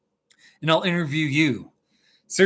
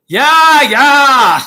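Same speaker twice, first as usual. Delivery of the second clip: loud, high, excited yeah; excited shout; 10 semitones higher, 12 dB louder than usual. Real, not acted. Streamer shouting back to the live chat, excited. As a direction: belt it out in delight.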